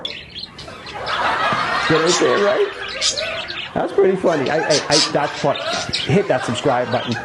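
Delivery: real laughter breaking through the words; laughing. Real, not acted. Host cracking up after the joke, laughing through words. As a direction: talk through a fit of giggles.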